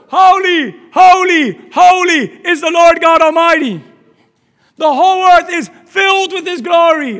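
loud, high cries of praise; angels' proclamation. Acted. Seraphim call to each other in the throne room, loudly exalting. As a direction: proclaim each "holy" with full force. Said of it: "They're saying this to each other".

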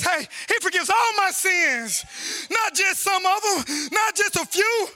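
high, emphatic scripture proclamation; exultant preaching. Real, not acted. Preacher proclaiming all sins forgiven, exultant preaching. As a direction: punch each phrase out to the congregation.

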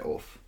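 oof said soft and quick, Southern Kiwi way; Southern New Zealand oof. Shown as a demonstration. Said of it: "the way I say".

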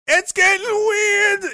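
very high, drawn-out comic yell; high loud wail. Acted. Streamer panicking comically about a creepy book, high loud wail. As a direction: blow it up into a slow, high wail.

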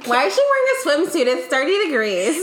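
high, loud scandalised gossip; judgy onlooker. Retold or imitated. Onlookers gossiping about her swimsuit, high and scandalised. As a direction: sneer at her outfit from the crowd.

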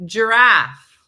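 loud, drawn-out "Giraffe", jur-AFF; emphatic corrective "Giraffe", first vowel sounded; two syllables. Shown as a demonstration. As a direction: drill both beats of "Giraffe". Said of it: "It's two syllables".

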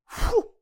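loud puff of admiration; a forceful, wowed exhale; impressed whew. Real, not acted. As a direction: blow out an overwhelmed breath. Overwhelmed by tag team wrestling.

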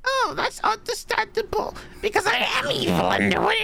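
very high squeaky villain voice; Weevil Underwood. Acted. Orphan reveals himself as the villain, very high gleeful squeal. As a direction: squeal it as a cartoon bug villain. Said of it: "I thought you were Weevil Underwood".

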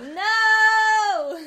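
long, high, loud wail of no; mock dismayed cry. Listener reacting to Niall held at gunpoint, mock dismay.

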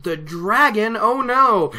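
high, rising mock dread; a villager's hammed-up horror; mock freaking out. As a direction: say it like the sky is falling. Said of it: "really just freaks out".

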